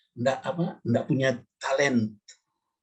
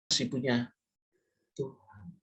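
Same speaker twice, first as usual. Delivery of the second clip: dramatic whisper; 6 dB quieter than usual. Real, not acted. Preacher telling the youth God is still there, whispered. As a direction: breathe the word in a whisper.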